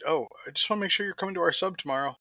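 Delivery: lightly voiced text message, high and fast; high fast imitation. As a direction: read it out high and quick. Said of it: "I get a, an update".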